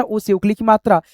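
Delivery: normal talking voice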